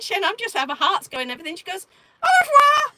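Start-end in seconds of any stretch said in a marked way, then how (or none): 2.2-2.9 loud, high sing-out goodbye; high mock farewell. Storyteller imitating the French policewoman leaving, high and loud. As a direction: exaggerate the French officer's send-off. Officer's farewell, acted in retelling.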